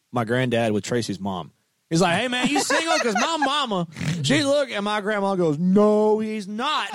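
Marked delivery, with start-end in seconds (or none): 2.2-4.7 much higher, playful sales pitch; imitated eager matchmaker. Singer matchmaking his mom, acted. 5.6-6.9 loud, high drawn-out "No"; mock-indignant grandma voice, pitched high; imitated objecting grandma. Grandma objecting, acted in story.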